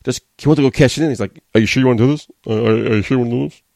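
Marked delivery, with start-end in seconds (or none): 1.5-3.6 hesitant, stumbling mock referee; mock confused referee. Referee checking on the cash-in, mock confusion. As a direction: imitate a dithering official, tripping on the first word. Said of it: "he was so confused, didn't know".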